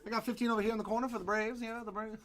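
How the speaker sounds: fast, high auction bid call; auctioneer. Pretend. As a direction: shout the bid from the corner. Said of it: "I feel more like an auctioneer than a breaker".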